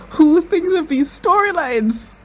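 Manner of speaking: high, stretched-out question; slow, high-pitched amazement; incredulous amazement. Real, not acted. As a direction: ask it in squealing disbelief. Amazed at the show's plot.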